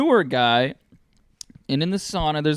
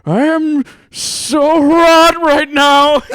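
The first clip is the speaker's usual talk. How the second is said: high, loud, slow, laughing moan; hammed-up overheated man, laughing through it; mock overheated moan; 13 semitones higher, 12 dB louder, much slower than usual. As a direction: whine it out like you're melting.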